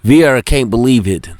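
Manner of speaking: usual speaking voice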